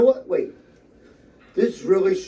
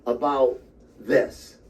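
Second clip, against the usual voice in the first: ordinary talk, nothing marked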